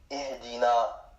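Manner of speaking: Ihdina drawn out slowly, syllable by syllable; slow model word. Shown as a demonstration. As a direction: say Ihdina slowly so students hear each sound. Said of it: "teacher models the Arabic word".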